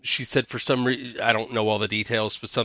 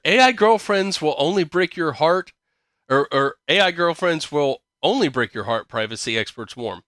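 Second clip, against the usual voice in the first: higher-pitched headline reading; article read-aloud; 5 semitones higher, 4 dB louder than usual. Acted. Podcast host reads an AI news headline to co-host, article read-aloud. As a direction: announce it like a headline.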